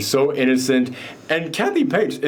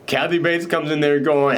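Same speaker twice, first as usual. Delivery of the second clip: usual speaking voice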